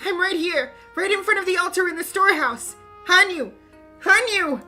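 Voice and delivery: loud, rushed calling; frantic shouting. Acted. Rika shouting where she is so Hanyu can find her, frantic. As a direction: blurt it out and keep yelling.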